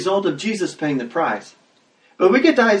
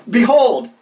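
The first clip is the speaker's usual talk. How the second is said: exclaimed behold; 5 semitones higher, 4 dB louder than usual. Shown as a demonstration. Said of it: "translate it by saying".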